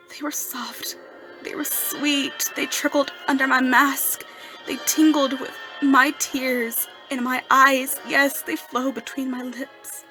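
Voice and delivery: loud, swelling rapture; impassioned rapture. Acted. Erik describing her tears under his mask, swelling with rapture. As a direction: let each phrase surge with feeling.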